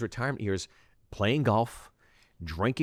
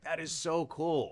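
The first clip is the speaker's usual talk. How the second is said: awed; 5 semitones higher, 4 dB quieter, slower than usual. Retold or imitated. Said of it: "I was like".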